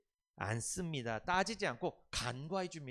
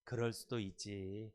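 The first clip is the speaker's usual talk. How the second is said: slow gentle greeting; 6 semitones lower, 5 dB quieter, slower than usual. Shown as a demonstration. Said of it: "시작".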